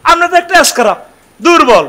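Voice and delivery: high, loud contemptuous taunt; mocking unbelievers. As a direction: sneer down at the prophet. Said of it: "তেরো নম্বর গালি এসকেরাপ". (নবীকে তুচ্ছ করে চড়া গলায় ব্যঙ্গ)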